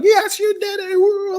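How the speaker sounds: high, loud mock cheer of the family; cheering crowd. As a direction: whoop it like fans at the finish line. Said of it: "Everybody like".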